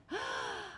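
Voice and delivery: sharp, high intake of breath; imitated gasp. Guide spots the stars, sharp surprised gasp. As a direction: catch your breath in surprise. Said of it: "she looked up and she gasped".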